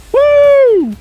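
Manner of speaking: very high loud woo; celebratory whoop. Real, not acted. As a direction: whoop with joy. Celebrating a kill.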